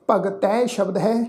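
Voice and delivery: ordinary talk, nothing marked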